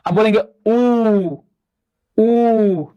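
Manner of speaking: slow held ऊ for pupils; drawn-out vowel ऊ. Shown as a demonstration. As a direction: stretch ऊ long, lips rounded. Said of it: "बस बोल के आप समझ जाएंगे".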